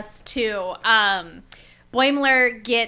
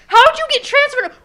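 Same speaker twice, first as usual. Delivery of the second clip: high, very loud outraged squawking; freaking out; 14 semitones higher, 13 dB louder, faster than usual. Retold or imitated. Officer protesting that he should be in charge, acted freak-out. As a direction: fire off frantic, indignant questions at full voice. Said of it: "starts freaking out".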